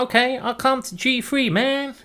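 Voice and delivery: very high voice of a chess knight giving in; high-pitched knight voice. Pretend. Knight agreeing to the player's move, high-pitched. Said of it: "Why does the knight have a high-pitched voice?".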